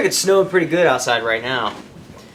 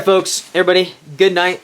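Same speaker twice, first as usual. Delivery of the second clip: usual speaking voice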